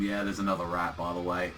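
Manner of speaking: low, soft offhand imitation; nonchalant. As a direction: mention it as if nothing has happened. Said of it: "he's very nonchalant about having his hand melted".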